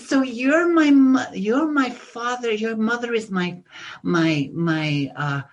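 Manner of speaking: high, loud gushing welcome; excited cousin. Retold or imitated. Bronx cousin greeting visitors from Australia, high and gushing. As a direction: gush over long-lost cousins at the door. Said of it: "and she says".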